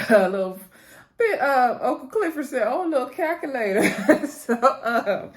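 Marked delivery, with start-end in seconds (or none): 1.2-5.3 laughing. Real, not acted.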